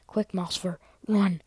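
loud urgent command, last word punched; sharp, loud battle order. Acted. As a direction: order a clanmate to flee, loudly. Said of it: "he ordered".

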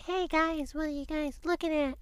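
put-on high, sweet child voice calling over; innocent little kid. Boy in daycare approaching kids in the corner, high and innocent.

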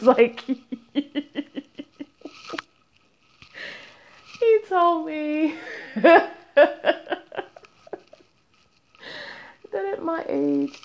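slow, high words broken up by laughing; helpless laughter. Real, not acted. Mom retelling her son's insult, laughing helplessly. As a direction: fight through giggles to tell it.

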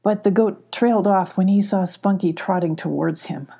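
usual speaking voice

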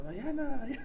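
imitando que llama a gritos a su novia en la calle oscura, sin subir el volumen; retold calling out. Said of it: "y yo gritando".

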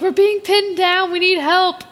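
urgent cry for help. Acted. Fan acting out Poe pinned down in battle, urgent cry for help. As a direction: call out as if pinned under fire.

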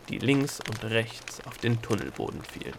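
ordinary delivery

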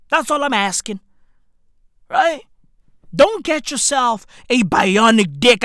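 ordinary talk, nothing marked

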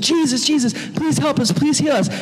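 high-pitched mock begging; imitated crowd crying out for healing; pleading crowd. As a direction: plead like a needy crowd clamoring for help. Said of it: "All those people just".

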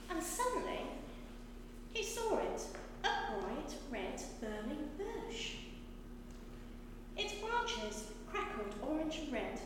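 measured storytelling with dramatic pauses; story narration. A narrator's reading. Reader tells children the burning bush story, in storytelling voice. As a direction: read it out to children, lifting the key moments.

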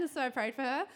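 ordinary talk

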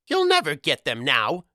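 high-pitched little goblin gloat. A narrator's reading. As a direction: crow over your victory like a goblin. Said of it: "the little goblin gloated".

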